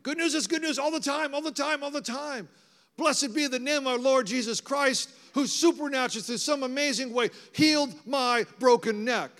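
high, driving proclamation; exultant preaching. Real, not acted. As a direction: build into loud, jubilant praise. Preaches exultantly at full force.

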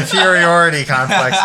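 Inferiority complex said high and loud through laughter; laughing through the word. Real, not acted. Stoned guy finally nails the word, laughing. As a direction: get the word out while cracking up. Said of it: "Fifth time's a charm".

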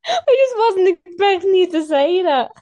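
high and loud, crying with laughter; laughing. Real, not acted. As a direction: squeal it through tears of laughter. Laughing fit at friend's answer.

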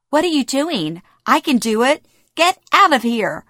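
indignant outburst from a furious mother; furious yelling. A narrator's reading. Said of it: "she yells at me".

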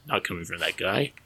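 ordinary talk

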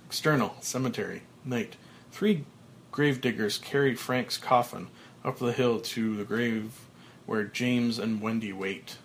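usual speaking voice

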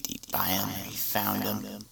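whisper. Real, not acted. Conspiratorial aside.

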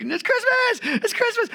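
very high, loud squeal; high-pitched childlike squeal of joy; excited child. Retold or imitated. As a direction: shriek it like an overjoyed kid. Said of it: "It's like".

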